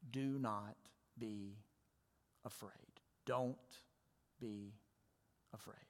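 soft, low, trailing almost to nothing; slowly, one word at a time, with long pauses; gentle reassurance. Acted. Preacher comforting the church with Jesus's command, hushed and gentle.

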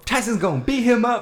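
high, loud mock hype man; mock Don King. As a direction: hype it up like a promoter. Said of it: "Don King hype".

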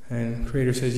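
ordinary delivery, nothing marked

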